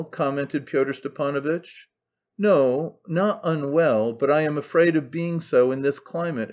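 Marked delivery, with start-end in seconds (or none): none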